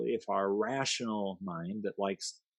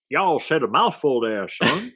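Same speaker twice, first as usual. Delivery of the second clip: mock folksy cowboy; 5 semitones higher, 8 dB louder than usual. Playful folksy put-on reply.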